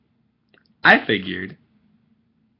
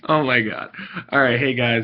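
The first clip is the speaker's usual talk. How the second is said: ordinary talk, nothing marked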